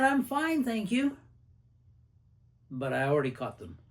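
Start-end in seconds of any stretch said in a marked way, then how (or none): 0.0-1.2 high, falsely cheerful reply; a chirpy high brush-off hiding upset; feigned fine. Retold or imitated. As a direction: say you're fine when you clearly aren't. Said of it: "I knew they were upset".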